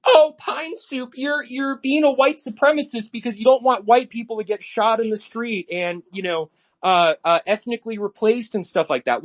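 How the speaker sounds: sarcastic, high-pitched parody of an online critic; mock whiny commenter. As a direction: sneer it out like a scandalized troll. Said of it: "in my comment section".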